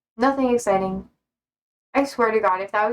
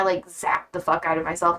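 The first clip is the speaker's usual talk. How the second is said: normal talking voice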